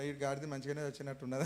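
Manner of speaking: quiet inner thought. Retold or imitated. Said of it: "నేను అనుకుంటా".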